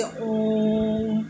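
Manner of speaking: ऊं stretched out very long on a level note; slow, sustained ऊं; prolonged vowel. Shown as a demonstration. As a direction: hold ऊं for triple length. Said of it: "तीन गुना समय लगता है".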